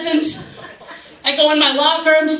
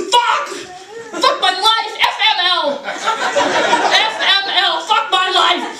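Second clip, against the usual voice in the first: high, hammed-up anguished cursing; mock despair; 6 semitones higher, slower than usual. TV viewer overreacting to a missing anchor, mock despair. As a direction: wail it like a ridiculous tragedy.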